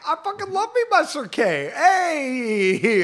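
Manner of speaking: high, loud fan's cheer; excited cheering. Real, not acted. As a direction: greet a returning favourite with whooping delight. Cheers a favourite character's return.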